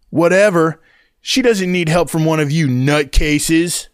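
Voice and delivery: put-on high, slow, contemptuous teen voice; snapping teen. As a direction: sneer it out, pitched high. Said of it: "Blondie snaps".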